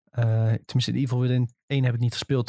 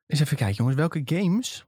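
normal talking voice

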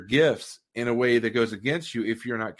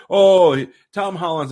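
loud, high "Oh" settling toward talking volume; half-hearted moviegoer heckle, burst up front; mock yell; 7 semitones higher, 10 dB louder, slower than usual. Moviegoer pointlessly calling out at a Spider-Man film, mock yell.

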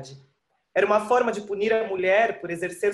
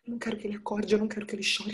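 hushed; 6 dB quieter, much faster than usual. Acted. Mother fears baby crying.

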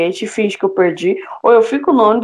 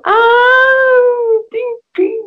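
high startled squeak; mock surprise; 13 semitones higher, 3 dB louder, much slower than usual. Girl realizing she dropped her ticket, startled. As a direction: jump at the realization.

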